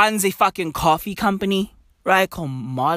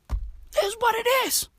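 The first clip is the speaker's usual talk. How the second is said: high comic voice; 13 semitones higher, 6 dB quieter than usual. Acted. Resigned line repeated in put-on voice.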